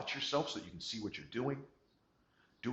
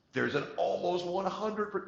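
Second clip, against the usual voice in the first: high, slow, hammed-up announcer swell; mock dramatic build; 11 semitones higher, 9 dB louder, slower than usual. Shown as a demonstration. Presenter teasing the audience with a mock dramatic high slow voice. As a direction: stretch it out like a big reveal.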